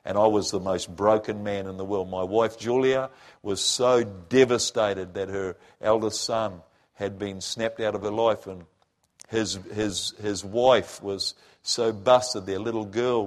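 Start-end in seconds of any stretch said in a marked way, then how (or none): none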